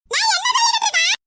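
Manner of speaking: high-pitched, exasperated objection; exasperated protest. Acted. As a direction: protest at being interrupted, pitch jumping up. Cut off while telling the news. (எரிச்சலான, உயர்ந்த குரல்)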